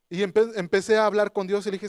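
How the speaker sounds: normal talking voice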